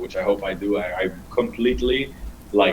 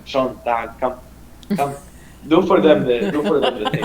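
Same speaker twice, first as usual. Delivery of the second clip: imitating mom; 4 semitones higher than usual. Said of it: "she's like".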